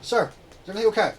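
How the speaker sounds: calling through door. Acted. Guard calling through the door to the boss, urgent. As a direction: shout through the door to check.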